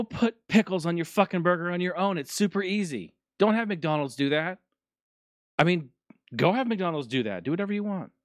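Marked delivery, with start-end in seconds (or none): none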